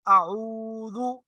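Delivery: आऊदु with the ū held very long and slow; very drawn out. Shown as a demonstration. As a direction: stretch the ऊ of आऊदु as far as it goes. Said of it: "वाओ मद्दा कहलाती है".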